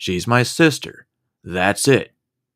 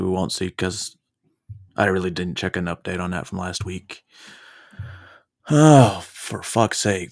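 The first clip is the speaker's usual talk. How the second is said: ordinary talk, nothing marked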